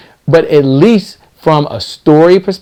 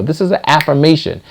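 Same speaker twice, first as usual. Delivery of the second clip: normal talking voice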